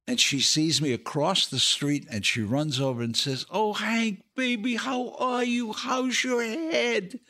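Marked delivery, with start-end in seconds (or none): 3.5-7.2 high, cooing put-on woman's voice; imitated starlet greeting, drawn out at the end; Sophia Loren. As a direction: gush over him in a high voice. Sophia's greeting acted in story.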